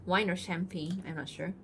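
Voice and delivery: normal talking voice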